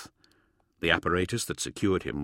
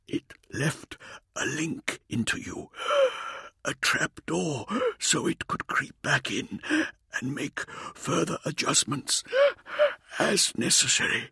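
strained, faltering voice, near tears; struggling to speak; 8 semitones higher than usual. A narrator's reading. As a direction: push the words out, barely holding on. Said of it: "struggling to speak".

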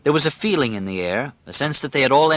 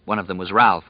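usual speaking voice

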